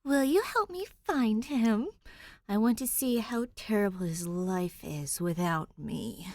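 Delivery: high, loud growling bride ghost. A narrator's reading. Ghost growling at Danny to find her ex. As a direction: snarl it like a spiteful ghost. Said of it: "growls the ghost".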